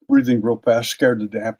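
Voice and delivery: ordinary talk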